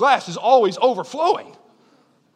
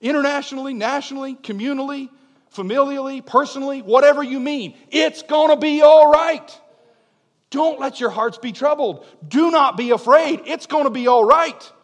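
preaching climax; 4 semitones higher, 5 dB louder than usual. Acted. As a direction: reassure the whole congregation loudly. Climax of sermon.

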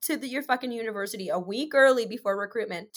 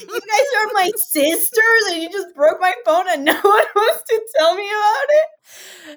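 high, loud indignant rant; retold outrage; 9 semitones higher, 8 dB louder than usual. Confronting sisters who broke her phone, hammed-up outrage. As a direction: build to a loud, stretched-out incredulous question.

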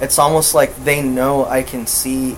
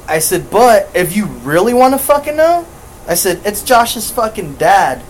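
raised, higher voice, retelling a heated argument; snapping back at him, pitched up; indignant raised retort; 6 semitones higher than usual. Retold or imitated. Retelling heated argument over keys.

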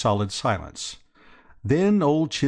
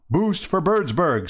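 higher, slow reverent slogan; 8 semitones higher, 4 dB louder than usual. A narrator's reading. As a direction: echo the slogan reverently, drawn out. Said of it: "said the other chappies reverently".

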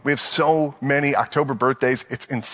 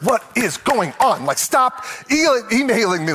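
mock exasperation; 6 semitones higher than usual. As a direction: plead with the inbox to stop. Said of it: "you're like".

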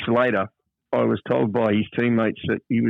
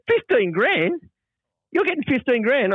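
much higher incredulous repeat; stunned disbelief; 13 semitones higher than usual. Retold or imitated. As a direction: repeat the sum in shock. Teammate stunned by the salary.

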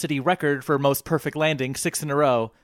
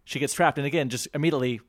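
ordinary talk

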